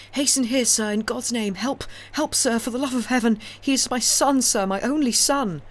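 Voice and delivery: high, loud desperate cries for help; frantic pleading. A narrator's reading. Old father begging stranger to save his son, frantic. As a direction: beg for your drowning son. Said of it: "said the old man frantically".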